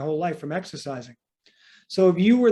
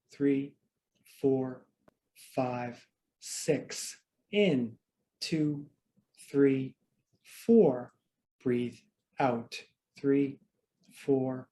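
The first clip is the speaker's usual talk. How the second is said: guided breathing count; 4 semitones lower, much slower than usual. Acted. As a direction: count the breaths slowly to calm them. Coach counts breathing for class.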